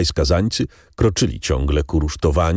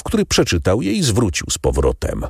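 ordinary delivery, nothing marked

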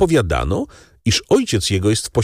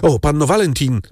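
calling out; 7 semitones higher than usual. A narrator's reading. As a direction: call to her across the room. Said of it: "zawołał Franz".

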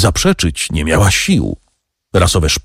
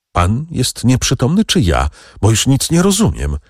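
high, bewildered outcry; stunned young woman exclaiming, pitch leaping up; dazed exclamation; 8 semitones higher than usual. A narrator's reading. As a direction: exclaim it in a daze, voice jumping higher. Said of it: "wykrzyknęła w otumanieniu".

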